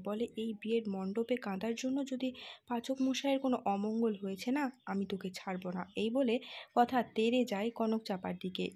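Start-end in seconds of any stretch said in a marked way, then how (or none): none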